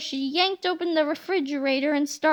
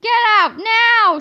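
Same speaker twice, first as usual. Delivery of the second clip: loud, higher furious order; angry shout; 7 semitones higher, 9 dB louder than usual. Acted. Yesterday George drives his other self away, angry shout. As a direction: bark the order to leave. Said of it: "shouted Yesterday George angrily".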